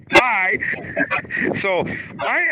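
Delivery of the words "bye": a high, louder flippant "Bye"; curt bye. Retold or imitated. Man ending a bad date with a high, loud flippant bye. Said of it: "And I'd be like".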